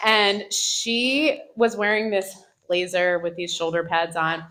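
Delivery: usual speaking voice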